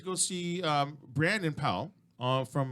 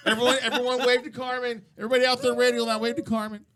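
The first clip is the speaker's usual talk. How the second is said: loud, raised-pitch call to everyone; rally the listeners in a raised voice; 8 semitones higher, 5 dB louder, faster than usual. Real, not acted. Host calls everyone to wave to Carmen, calling out.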